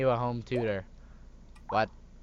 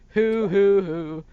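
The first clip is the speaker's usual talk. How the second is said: loud, higher hammed-up fake sobs; fake crying hoots; 8 semitones higher, 10 dB louder, much slower than usual. Mom hamming up fake sobs at her son. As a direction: ham up a fake cry. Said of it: "it's so fake".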